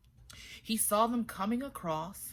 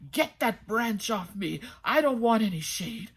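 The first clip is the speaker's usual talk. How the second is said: talking rock voice; 3 semitones higher, 8 dB louder than usual. A narrator's reading.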